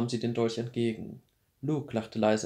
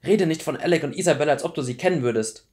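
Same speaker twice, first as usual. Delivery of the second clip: louder, indignant warning; angry rebuke; 4 semitones higher, 8 dB louder than usual. A narrator's reading. Clary rebukes Luke for naming her friends, angry. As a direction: shut him down crossly.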